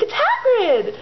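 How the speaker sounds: very high, acted squeal of delight; excited. Hermione to the boys on seeing Hagrid, excited. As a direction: announce it in a thrilled high pitch.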